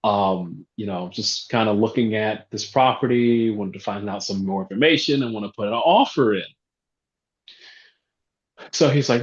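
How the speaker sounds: ordinary delivery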